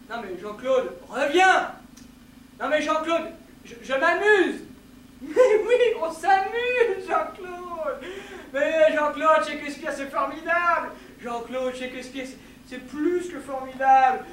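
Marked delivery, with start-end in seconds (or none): 0.1-4.6 loud, exasperated call to come back; protesting call. Acted. Calling Jean-Claude back in a quarrel, loud protest. 5.2-10.9 high, loud, wailing protest; loud pleading. Acted. Pleading with Jean-Claude over Shakespeare, loud wail.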